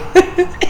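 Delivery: high breathy laugh; short high giggle. Real, not acted. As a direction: burst out laughing at the memory. Laughing at the memory.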